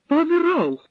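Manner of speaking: high, loud panicked cry of his name; scared girl's high cry; frightened cry. A narrator's reading. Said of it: "тільки й могла скрикнути вона".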